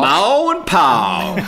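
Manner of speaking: very slow, stretched American twang; mock American accent. Father imagining daughter's greeting, exaggerated American accent. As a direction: drag out each vowel in a cartoon American accent. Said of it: "when she's American".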